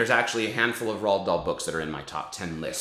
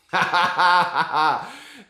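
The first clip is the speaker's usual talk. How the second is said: witch cackle; 7 semitones higher, 6 dB louder than usual. Acted. After witch's speech, cackle.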